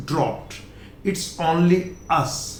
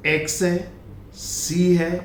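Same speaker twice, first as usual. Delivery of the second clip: ordinary talk, nothing marked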